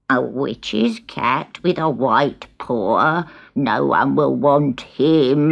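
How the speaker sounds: put-on a scornful old hag refusing; ugly old hag. Hag refusing to take Gobelino, low and slow. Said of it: "the hag refused".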